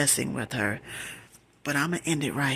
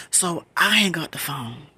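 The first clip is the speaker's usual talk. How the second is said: ordinary delivery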